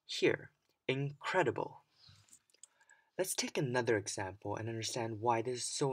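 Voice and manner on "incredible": "incredible" stressed on CRED; stress on second syllable. Shown as a demonstration. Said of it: "the stress word for incredible would be".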